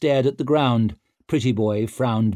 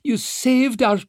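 high pleading voice; 4 dB louder than usual. A narrator's reading. Dancer cut off.

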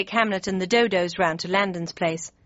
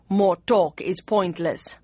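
slow pronouncement; slower than usual. Acted. Bismarck's pronouncement.

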